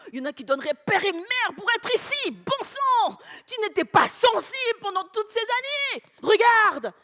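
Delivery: rising to high, loud outrage; indignant reproach. Acted. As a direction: reproach her, building to a sharp command. Husband reproaches her in quarrel.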